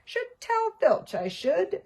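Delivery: high, slow mock-innocent poltergeist; falsely virtuous sing-song; saintly voice. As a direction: pretend to be pious while teasing. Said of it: "in a saintly voice".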